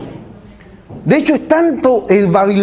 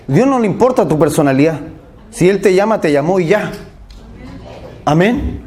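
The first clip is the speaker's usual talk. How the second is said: ordinary talk, nothing marked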